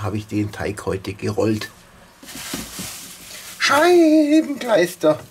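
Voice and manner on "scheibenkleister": high, slow, loud cry of dismay; shocked outburst. Real, not acted. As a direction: yelp it out in alarm. Pizza roll burning in oven.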